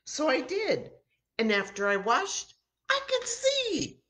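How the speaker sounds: high, thrilled and quickening; healed man explaining to the crowd, thrilled; excited telling. Acted. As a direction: let the joy burst on the last words. Healed man telling his miracle.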